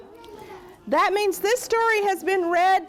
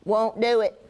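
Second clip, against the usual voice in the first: low gruff refusal; 8 semitones lower than usual. Acted. Rat refuses.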